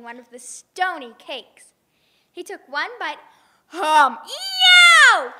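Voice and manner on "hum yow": a hum then a high loud yowl; loud high yow of pain; pained yelp. Acted. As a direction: yelp as a tooth breaks. Giant bites stone cake.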